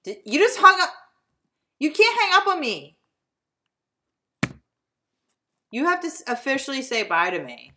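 high, loud indignant protest; outraged. Real, not acted. Outraged at being hung up on.

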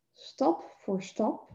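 very slow and soft, pacing each step. Shown as a demonstration. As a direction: let each word land like a footstep. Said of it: "heel bewust zet".

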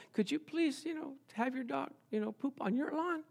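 mock polite request. Mock-sweet request to neighbor.

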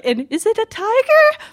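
very high mom imitation; mother's voice. Mom still guessing the animal, very high imitated voice. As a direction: play mom guessing, pitched way above your own voice. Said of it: "she's just like".

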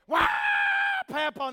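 retold kid yelling Papa; child's yell for Papa. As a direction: call Papa like a hurt toddler. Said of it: "both of them yelled for Papa".

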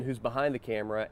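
ordinary talk

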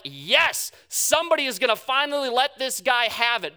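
loud, high, triumphant shout; eager crowd. Acted. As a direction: cheer like a crowd that wants blood. Crowd cheering Jesus on.

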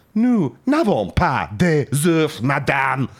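put-on French accent, one word at a time. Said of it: "They answer one word at a time".

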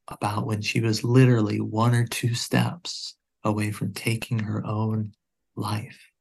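usual speaking voice

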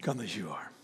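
soft, low invitation; hushed repeat. Real, not acted. Preacher repeating an invitation to the church, softly and low. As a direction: let the refrain sink in, low and quiet. Refrain repeated much softer and lower.

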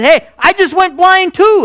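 shocked, frightened exclamation; panicked. Acted. Buddy answering the blinded soldier, panicked.

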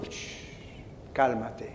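long soft shush, then low calm word; hushed, low and unruffled; gentle hushing. Retold or imitated. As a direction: soothe him down softly. God hushing him.

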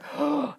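sharp mock gasp of shock; viewer's dramatic gasp. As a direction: suck in a breath, acting stunned. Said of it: "It's a bit like".

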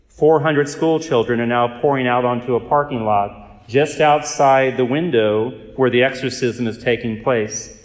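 normal talking voice

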